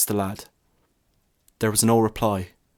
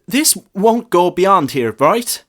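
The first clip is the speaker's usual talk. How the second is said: high, louder, slow anxious plea; panicky; 9 semitones higher, 7 dB louder, slower than usual. A narrator's reading. Boy nervously asking the priest for secrecy. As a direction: beg for reassurance, worried. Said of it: "in a panicky voice".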